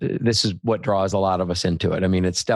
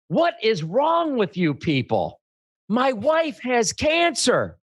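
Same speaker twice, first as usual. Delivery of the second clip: high-pitched, slow, drawn-out outburst of indignation; outraged customer; 12 semitones higher, much slower than usual. Retold or imitated. Upset husband rebuking the car salesmen, outraged. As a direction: act out a customer wailing in outrage, stretching each word.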